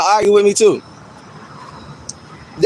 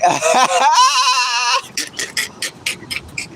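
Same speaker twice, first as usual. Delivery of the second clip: big laughing fit. Real, not acted. Streamer cracking up at own molecules comeback, high cackle. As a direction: crack up completely, high and long.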